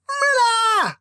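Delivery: drawn-out desperate wail. Acted. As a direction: wail for your twin like a child. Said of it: "wailed Jacob".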